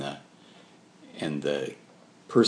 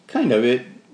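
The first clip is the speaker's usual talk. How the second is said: usual speaking voice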